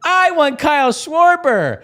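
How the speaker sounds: high, drawn-out imitated little girl; whine-shout it like an eager small daughter; dziecięcy głosik.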